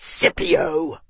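sharp high snarl on the name. A narrator's reading. Arnas correcting his advisor, snarled in anger. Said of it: "Arnas snarled".

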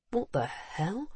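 hushed, bewildered mutter under the breath; baffled mutter. A narrator's reading. Visitor watching her race off, muttering in bafflement. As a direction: mutter it softly to yourself. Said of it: "the visitor muttered".

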